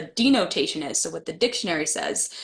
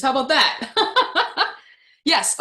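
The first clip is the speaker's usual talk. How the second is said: usual speaking voice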